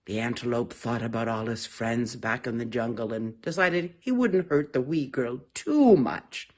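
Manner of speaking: ordinary delivery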